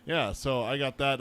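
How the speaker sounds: usual speaking voice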